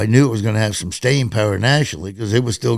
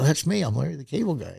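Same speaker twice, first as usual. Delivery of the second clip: retold proud claim, higher pitch; pleased with yourself, voice lifted; all proud; 6 semitones higher, 5 dB quieter, faster than usual. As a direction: eagerly own up to your stage name. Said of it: "I was all proud of it".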